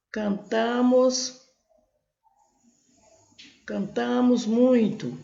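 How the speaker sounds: cantamos drawn out slowly, syllable by syllable; teacher slowly sounding out the verb; slow demo. Shown as a demonstration. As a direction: spell out the verb ending for the class. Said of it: "Eu sei que a desinência aqui é nós".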